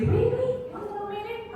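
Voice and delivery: very high-pitched displeased questions; unhappy disbelief. Shown as a demonstration. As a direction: query it high, as unwelcome news. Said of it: "My intonation changes depending on the context".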